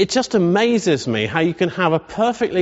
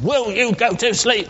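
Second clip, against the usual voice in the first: put-on raised, fed-up call at a crying child; exasperated parent; 6 semitones higher than usual. Tired parent yelling at the baby in the night, exasperated. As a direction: snap at the baby to sleep. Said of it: "like this".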